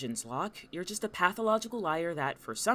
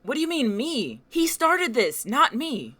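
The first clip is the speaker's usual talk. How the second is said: high, loud outraged shouting; furious, incredulous outburst; indignant protest; 12 semitones higher, 10 dB louder than usual. Acted. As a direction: yell in your own defence, blaming the other. Caught fighting, protests loudly.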